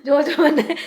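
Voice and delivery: stumbling over words through laughter; laughing stammer. Real, not acted. Vlogger to viewers starting the mishap story, laughing. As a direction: try to start but keep laughing.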